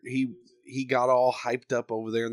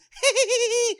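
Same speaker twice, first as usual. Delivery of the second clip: a very high cackling mock laugh; shrill squealing imitation laugh; high goofy laugh imitation. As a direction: mimic his drunken giggle. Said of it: "that's his laugh".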